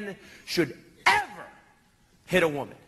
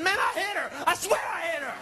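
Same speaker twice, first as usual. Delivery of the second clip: eager insistence; 9 semitones higher than usual. Retold or imitated. Insisting to cops he hit her.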